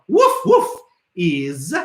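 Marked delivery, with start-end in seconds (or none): 0.1-0.7 high playful woof woof; barking imitation. Shown as a demonstration. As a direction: bark like a little dog. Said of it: "The word for dog".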